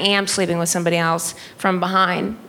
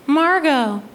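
high mom voice; high-pitched imitation of mom; mom impression; 7 semitones higher than usual. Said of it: "And my mom said".

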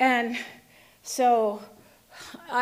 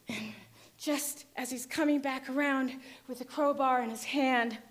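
ordinary delivery, nothing marked

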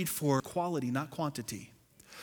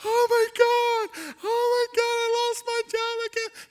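much higher, louder hammed-up crying over a lost job; mock wailing; 7 dB louder than usual. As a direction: wail it like an overdramatic church member. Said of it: "I've had people like".

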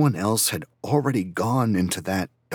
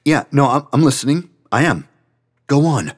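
flustered; 8 semitones higher, 8 dB louder than usual. Acted. Kevin hastily reassuring upset friend.

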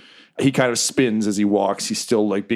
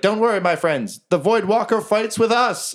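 a higher, grand rallying voice; heroic speech; 12 semitones higher than usual. Acted. Jerry reassuring the chained goblins, lightly grand. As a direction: reassure them like a hero. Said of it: "He's like".

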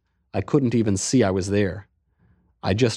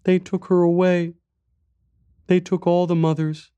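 put-on high, small child voice; little boy; 8 semitones higher than usual. A narrator's reading. Boy tells stranger about lost mother, little boy's voice. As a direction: speak as a young boy, pitched high. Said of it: "he said".

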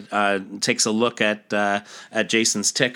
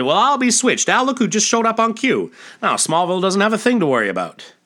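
excited townsman; 10 semitones higher, 4 dB louder than usual. Acted. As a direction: whoop it up like a small-town yokel. Gas station man sees Superboy.

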